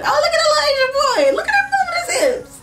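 high, fast, laughing auntie squeal; cheek pinching aunt. Retold or imitated. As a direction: gush over him like a delighted auntie. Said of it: "all the aunties be like".

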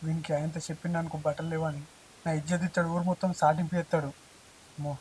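fretful inner aside, very soft; anxious aside. Acted. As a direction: mutter the worry under your breath. Worried aside to himself.

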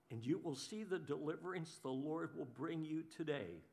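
soft, low, reassuring voice; steady them with quiet peace; calm Moses. Retold or imitated. Moses calmly reassuring his panicked people, soft and low. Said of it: "Moses answered the people".

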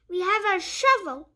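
lively picture-book read-aloud; reading to children. Acted. Reading a puppy picture book to young viewers, bright storytime voice. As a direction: read it like storytime for little kids.

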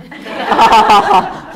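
loud laugh. Real, not acted. Laughing at own example.

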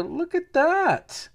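very high, drawn-out squeal of delight; excited amazement. Real, not acted. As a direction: marvel in a slow, sky-high voice. Amazed at a card pulled.

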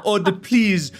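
high, slow pleading in a fake Portuguese accent; drawn-out, whiny Portuguese accent imitation; mock Portuguese accent. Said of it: "like, Portuguese accent".

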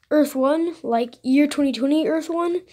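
acted bafflement, rising at the end; really confused. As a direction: ask it loudly in mock disbelief. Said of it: "like, really confused".

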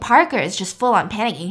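ordinary delivery

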